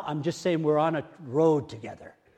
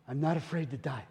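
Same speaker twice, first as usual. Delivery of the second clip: ordinary talk, nothing marked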